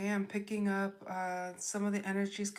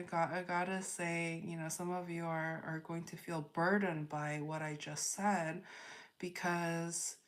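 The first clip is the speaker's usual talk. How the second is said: normal talking voice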